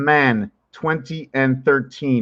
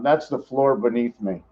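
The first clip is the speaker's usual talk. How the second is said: ordinary delivery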